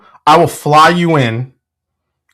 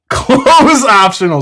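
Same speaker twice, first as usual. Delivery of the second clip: laughing quote; 9 semitones higher, slower than usual. Retold or imitated. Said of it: "says Nathan".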